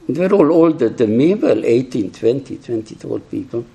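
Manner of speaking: ordinary talk, nothing marked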